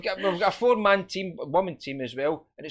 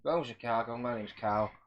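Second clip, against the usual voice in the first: low, softer mock Chicago voice; mock Chicago accent; 7 semitones lower, 7 dB quieter than usual. Teasing co-host's accent attempt with own low Chicago impression. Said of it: "my Kyle's Chicago accent".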